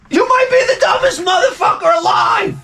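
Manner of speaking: high, screaming insult; furious shout. Acted. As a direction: blow up at him completely.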